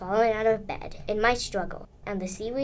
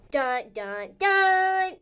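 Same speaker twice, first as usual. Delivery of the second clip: sung suspense sting, last note high and loud; mock cliffhanger sting, held final note; dramatic dun-dun-dun; 11 semitones higher, 5 dB louder, much slower than usual. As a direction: voice a soap-opera sting, leaping up at the end. Voiced cliffhanger music sting.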